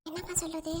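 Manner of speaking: hushed reply; whispering. Acted. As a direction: whisper back. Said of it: "குசு குசுன்னு பேசிக்கிறீங்க".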